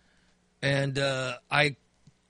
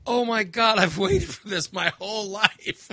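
put-on higher, louder, gushing teen; imitating teenage daughter; 5 semitones higher, 4 dB louder than usual. Dad imitating his overjoyed fourteen-year-old daughter, high and dramatic. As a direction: gush dramatically, breaking into a laugh. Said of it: "my daughter's fourteen years old, she goes".

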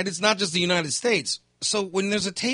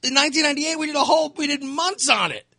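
much higher, louder mock reply; raised mock reply; 9 semitones higher, 4 dB louder than usual. Media answering Trump's complaint, raised mock reply. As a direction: fire back at Trump in a raised voice. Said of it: "He goes".

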